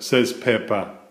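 plain reading voice

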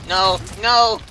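high, loud panic; screaming in fright; panicked yelling. Real, not acted. Panic while trapped in game.

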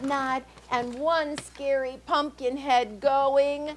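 chanted, bouncing read-aloud rhythm; sing-song it like a picture book; rhythmic story refrain. A narrator's reading.

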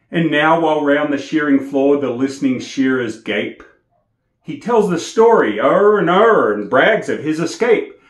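lively bush ballad recital, rising into high loud drama; poem recitation. Acted. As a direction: recite it like a campfire yarn, building to the fight.

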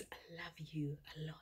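cooing to a lover. Pretend. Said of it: "That means".

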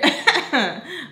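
high, loud mocking laugh; imitated laugh. Cleaner mimicking the laugh she overheard, mocking laugh. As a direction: mimic her obnoxious laugh. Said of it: "like that".

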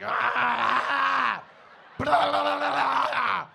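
loud high nonsense shouting; fake noises. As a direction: shout gibberish to cover up. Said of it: "started making noises".

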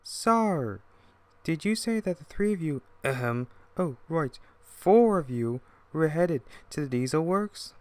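high, feigned-innocent twin voice; sly twin engine. Acted. Twin to Sheffield, setting up a trick, slyly innocent. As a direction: pitch it up, innocently curious.

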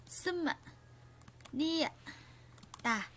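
Sum, Ni, Tả said slowly one by one, Sum and Ni pitched high; syllable by syllable. Shown as a demonstration. Teacher breaks sum nhì tả into syllables for students, slow and clear. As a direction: spell out Sum, Ni, Tả with a pause after each. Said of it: "các bạn lưu ý về cách phát âm".

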